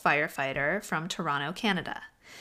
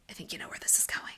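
whispering; 7 dB quieter than usual. Acted. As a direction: breathe it like a ghost story. Spooky storytelling whisper.

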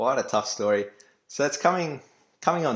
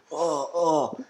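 pained groan; 5 semitones higher than usual. Retold or imitated. Injured brother groaning.

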